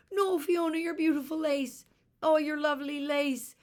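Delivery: high, loud hysterical wail; little sister hysterical over the cut lace; hysterical scream. Acted. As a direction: wail it in panic. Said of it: "Eilish screamed as she became hysterical".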